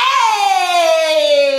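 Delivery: loud excited shout. Real, not acted. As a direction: yell it out. Reacting loudly.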